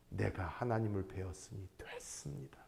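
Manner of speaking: soft, breathy whisper of surrender; humbled whisper. Retold or imitated. Job lying prostrate before God, whispering in surrender. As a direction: whisper it, bowed low in awe.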